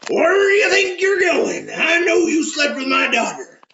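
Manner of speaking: high, hollered hillbilly accusation; angry old farmer. Acted. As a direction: shout it like a hillbilly farmer who caught them. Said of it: "the old farmer man standing there".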